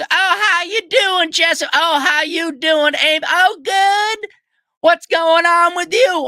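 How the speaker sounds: high, slow hammed-up Kentucky greeting; mock Kentucky accent. Mocking the next customer greeting everyone, slow high Kentucky accent. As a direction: stretch out a sugary Kentucky hello. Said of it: "Next person goes".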